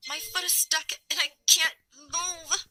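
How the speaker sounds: high, slow distressed call. A narrator's reading. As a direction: call for help, stretching the words. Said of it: "I called out".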